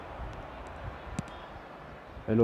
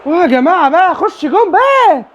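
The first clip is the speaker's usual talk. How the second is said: much higher, louder, slowed begging for a goal; pleading shout; 10 semitones higher, 4 dB louder than usual. Real, not acted. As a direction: beg the ball into the net. (توسل عالٍ بحماس)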